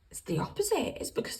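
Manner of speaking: whispered reassurance. Real, not acted. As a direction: softly reveal the truth.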